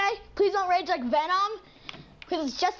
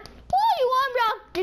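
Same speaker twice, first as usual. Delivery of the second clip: ordinary delivery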